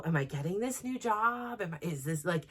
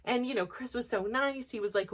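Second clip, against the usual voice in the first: ordinary delivery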